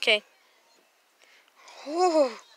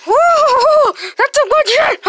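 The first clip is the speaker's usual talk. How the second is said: high, loud gleeful whoop; giddy high shout; excited whoop; 9 semitones higher, 5 dB louder, much slower than usual. Pretend. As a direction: cheer it out like a rowdy toy. Toy character whoops at drugs.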